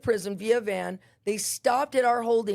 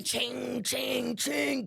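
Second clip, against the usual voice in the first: softer, ringing "ching" noises; imitated chains clinking; 2 semitones higher, 5 dB quieter, much slower than usual. Prisoner coming down the hall shackled, imitated chains clinking. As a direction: make rhythmic chain sounds with the voice.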